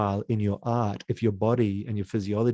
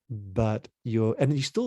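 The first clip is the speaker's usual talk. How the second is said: ordinary talk, nothing marked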